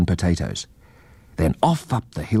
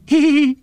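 high, loud squeaky laugh; a little mouse giggle burst; short shrill-high chuckle; mouse's laugh; 5 dB louder than usual. Acted. Mouse laughs after joke.

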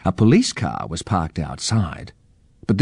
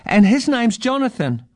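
put-on high-pitched child's tease; teasing young girl. A narrator's reading. Girl punches boy and gives his name, high teasing voice. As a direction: tell on him in a high little-sister voice.

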